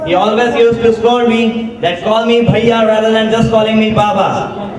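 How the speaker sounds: ordinary talk, nothing marked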